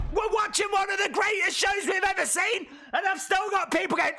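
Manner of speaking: exasperated shout. Real, not acted. Exasperated at chat viewers.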